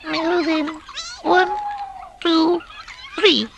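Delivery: very high tiny counting voice; elder field mouse counting in. A narrator's reading. Elder mouse to the carollers, tiny high count-in. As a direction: count the choir in, squeaky and small. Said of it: "was just saying".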